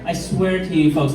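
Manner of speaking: ordinary talk, nothing marked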